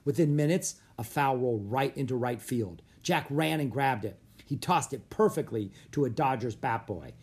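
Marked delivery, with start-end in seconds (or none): none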